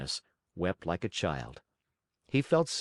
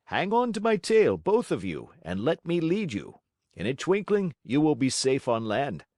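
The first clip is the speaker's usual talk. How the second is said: high, lively helpful fish voice; a friendly sea creature reassuring them; kindly big fish; 5 semitones higher, 4 dB louder than usual. Acted. Tunny offers help.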